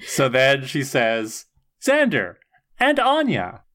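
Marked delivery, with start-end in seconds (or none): 1.8-3.6 mock robot greeting.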